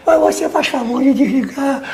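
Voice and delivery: high, mock-meek courtesy; mock polite request. General showing how he would never ask the journalist, mock polite. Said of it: "com voz de, de chefe, com voz de macho".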